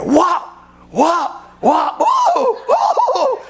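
high shouted whops turning into laughing woos; paddle whacks. Retold or imitated. Preacher mimicking the paddling he heard from the hall, high laughing yells. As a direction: belt it out like the licks and yelps from the office.

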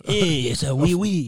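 put-on slow, hammy French accent; playful fake French; mock French accent. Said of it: "doing my French accent".

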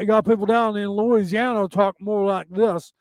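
put-on mushy Southern Louisiana accent, words blurred together; Louisiana mush mouth. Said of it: "Louisiana with their mush mouth".

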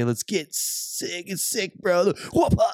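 slow, lazy bro drawl then a whoop; frat bro. Retold or imitated. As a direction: hype it up like a party bro. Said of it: "bros with, you know, pre-runner trucks and like to say".

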